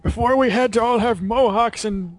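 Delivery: old war veteran reminiscing, hammed up; 1940s announcer voice. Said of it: "announcer guy".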